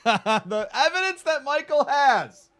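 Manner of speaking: high giggling through the words; laughing. Real, not acted. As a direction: laugh while you say it. Laughing mid-sentence.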